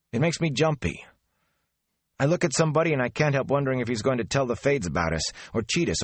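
words tumbling out on edge; jumpy. Acted. Mat jumpy, voiced higher.

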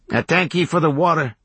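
put-on country kid calling. A narrator's reading. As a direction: call thanks across to the hermit. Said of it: "he called".

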